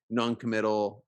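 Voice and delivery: ordinary talk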